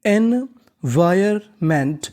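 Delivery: एनवायरमेंट very slow, in separate pieces; syllable-by-syllable. Shown as a demonstration. Teacher sounds out the full word, syllable-by-syllable. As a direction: draw out each part of एनवायरमेंट with gaps. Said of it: "तो पूरी meaning".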